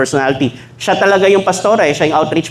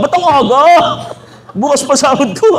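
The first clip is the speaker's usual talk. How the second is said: high, laughing excuse to courier; wife tells courier payday is tomorrow; flustered wife; 10 semitones higher than usual. Acted. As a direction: act caught off guard. Wife talks to Shopee courier.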